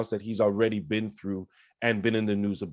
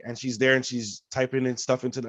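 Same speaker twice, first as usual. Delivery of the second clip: normal talking voice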